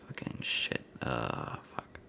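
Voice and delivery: hushed frustrated cursing to himself; muttered curses. Real, not acted. Typing a message while filming, quietly cursing.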